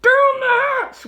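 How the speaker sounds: very high, loud, slow chorus; rowdy crew toast; crew shout. A narrator's reading. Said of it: "we all shouted".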